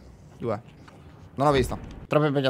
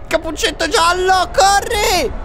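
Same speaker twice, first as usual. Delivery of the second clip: yelling run; 4 dB louder, much slower than usual. Real, not acted. Urging Yellow Raincoat to flee.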